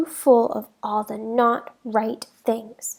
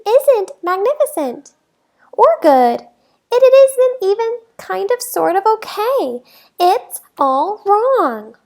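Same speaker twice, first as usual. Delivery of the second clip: dismay; 7 semitones higher, 5 dB louder than usual. A narrator's reading. Shocked the thing is wrong.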